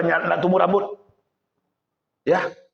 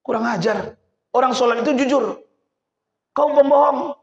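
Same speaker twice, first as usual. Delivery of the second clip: rising outrage; 6 semitones higher than usual. Acted. Young man outraged.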